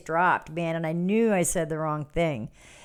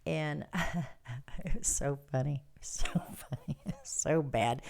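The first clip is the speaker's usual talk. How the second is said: breathless whispered laugh through the words; whispered giggling; 4 semitones lower, 7 dB quieter than usual. Real, not acted. Giggling softly while starting the funny story. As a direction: giggle it out under her breath.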